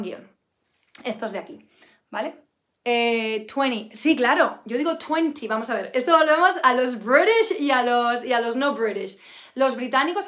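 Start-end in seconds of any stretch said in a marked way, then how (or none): none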